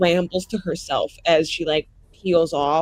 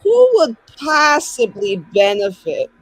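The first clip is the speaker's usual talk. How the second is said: loud, slow, anguished in-character plea; dramatic in-character question; 5 semitones higher, 9 dB louder, slower than usual. Acted. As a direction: wail the question out like a wronged hero. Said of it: "kinda be like".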